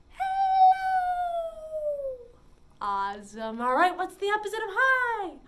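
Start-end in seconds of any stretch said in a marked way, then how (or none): 0.1-1.9 squealed hello, much higher and louder. Shown as a demonstration. Librarian squeals hello sky-high for the children. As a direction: squeak it as high as you can. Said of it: "say hello as high as we can".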